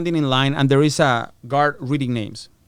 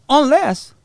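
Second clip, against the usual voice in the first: high, louder, suspenseful single word; dramatic reveal; 11 semitones higher, 5 dB louder than usual. Retold or imitated. Prosecutor hinting at a way out, dramatic. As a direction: dangle it like a sudden loophole.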